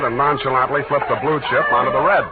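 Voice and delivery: clipped private-eye voiceover; noir narration. A narrator's reading. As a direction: tell it like a hard-boiled detective. Hard-boiled narration over music.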